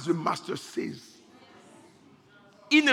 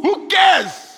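shout; 13 semitones higher, 12 dB louder than usual. Acted. Shouted climax of who cares.

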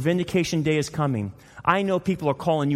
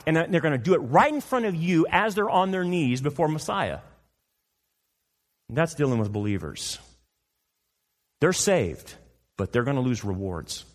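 normal talking voice

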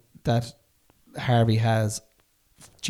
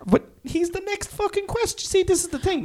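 much higher, exasperated protest; exasperated squeak. Real, not acted. Host protesting the question is next, high and exasperated. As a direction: throw your hands up in disbelief.

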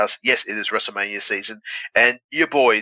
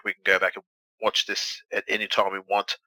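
ordinary delivery

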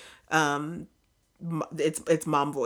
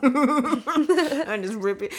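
laughing mock threat; 7 semitones higher, 4 dB louder, much slower than usual.

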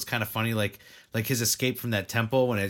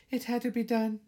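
tiny, very high-pitched voice; cute-girl voice. Retold or imitated. Girl explaining why she broke them, cutesy. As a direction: put on a high little girl's voice.

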